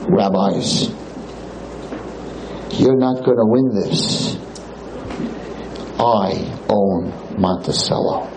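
low, slow, drawn-out threat; menacing club owner. Retold or imitated. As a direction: lean in low and slow like a man who owns the town.

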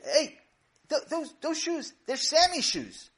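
high, startled exclamation; sudden realization. Acted. Mommy recognizing Sammy's shoes, high and startled. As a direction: catch it mid-thought in shock.

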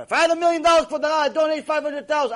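mock boastful congregant. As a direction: play the congregant who brags he'd give half a million. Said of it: "A lot of people says".

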